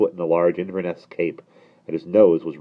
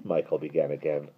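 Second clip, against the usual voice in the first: usual speaking voice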